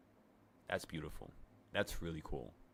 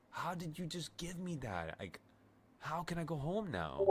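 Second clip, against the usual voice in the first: much higher, piling up baffled questions; incredulous questions; 8 semitones higher, 5 dB louder than usual. Retold or imitated. Questioning the airport staff about the free ticket, high and incredulous. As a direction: pitch it up in stunned disbelief.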